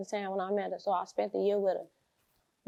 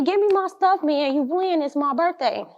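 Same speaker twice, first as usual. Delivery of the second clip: high, pushy mock-mom whine; impatient demand; 7 semitones higher, 5 dB louder than usual. Mother pressing the dealer on her birthday, high impatient demand.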